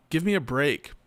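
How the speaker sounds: ordinary talk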